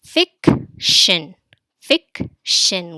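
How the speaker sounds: "Fiction" loud, as fic, pause, shin; split into syllables. Shown as a demonstration. Teacher drilling a new word, loudly split into syllables. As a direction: punch out each half of "Fiction". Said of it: "The final syllable here is shin".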